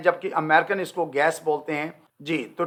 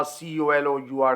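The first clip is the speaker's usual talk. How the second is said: letters spelt slowly, then the word; slow spelling out; 3 semitones lower, 3 dB quieter, slower than usual. Shown as a demonstration. Teacher spelling the British colour slowly. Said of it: "यू का इजाफा करेगा".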